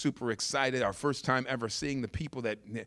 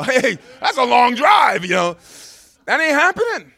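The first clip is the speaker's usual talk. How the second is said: loud, high comic yell; mock protest; 12 semitones higher, 13 dB louder than usual. Preacher balking at a long drive, mock protest. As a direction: holler it in hammed-up refusal.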